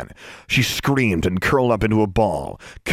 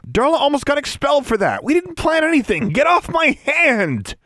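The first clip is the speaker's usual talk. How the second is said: high, strained, furious outburst; angry protest. A narrator's reading. Girl with her hand trodden on protests, furious. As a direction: protest high and tense through gritted teeth. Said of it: "Alexandra growled through gritted teeth".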